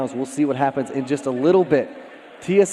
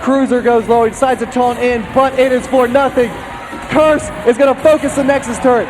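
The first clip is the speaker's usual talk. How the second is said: play-by-play; 11 semitones higher, 7 dB louder than usual. Acted. Casting the final push to victory.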